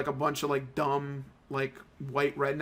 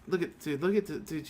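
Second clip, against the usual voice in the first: usual speaking voice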